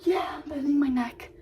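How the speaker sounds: horse's proud voice. Retold or imitated. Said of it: "and he was like".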